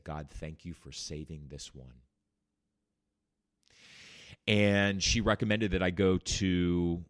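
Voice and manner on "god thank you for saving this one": much softer, lower, reverent; a doctor's whispered thanks to God; hushed prayer. Retold or imitated. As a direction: breathe it like a quiet prayer. Doctor praying quietly.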